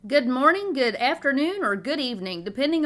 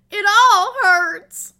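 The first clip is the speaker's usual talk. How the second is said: high, loud, slow crying wail; hammed-up sobbing child; imitating tearful wail; 11 semitones higher, 14 dB louder, much slower than usual. Mom imitating her hurt daughter, crying wail. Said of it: "through her tears".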